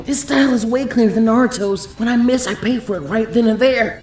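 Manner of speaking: confident fighter's aside, lightly voiced; lightly voiced Ino. A narrator's reading. Narrator voices Ino's dialogue.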